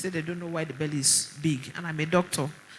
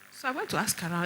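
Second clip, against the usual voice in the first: ordinary talk, nothing marked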